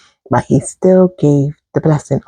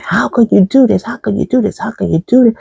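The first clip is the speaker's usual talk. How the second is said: higher, mock-angry accusation; mad repeated question; 6 semitones higher, faster than usual. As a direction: hammer the question at the brother who cheated. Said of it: "Esau is mad".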